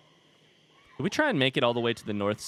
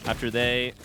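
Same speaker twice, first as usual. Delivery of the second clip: usual speaking voice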